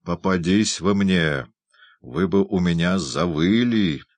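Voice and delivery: usual speaking voice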